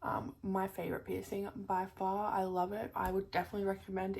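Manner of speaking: normal talking voice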